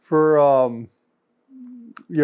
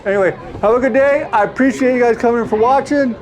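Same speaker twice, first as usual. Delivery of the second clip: upbeat sign-off; 7 semitones higher, faster than usual. Acted. Cheery vlog sign-off, fast.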